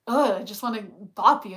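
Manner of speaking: exaggerated mock annoyance at a friend; hammed-up irritated rant; exasperated outburst. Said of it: "I'll be like".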